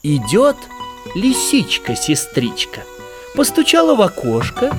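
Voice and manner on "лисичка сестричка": ordinary delivery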